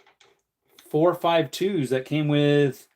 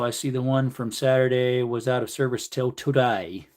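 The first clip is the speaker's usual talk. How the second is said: ordinary delivery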